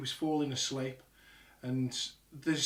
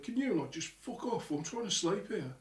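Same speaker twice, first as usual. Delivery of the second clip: high-pitched, held-back complaint; faint annoyed call; 5 semitones higher, 3 dB quieter than usual. Retold or imitated. Dad trying to sleep, complaining to those downstairs, faint annoyance. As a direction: imitate a tired dad pleading for quiet. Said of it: "you can only hear it slightly".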